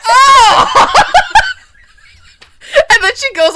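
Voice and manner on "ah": loud, high comic "ah!"; imitated pained scream. Co-host mimicking the actress hit by a soccer ball, pained yell. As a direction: yelp it out, laughing after. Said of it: "then she looks around and she goes".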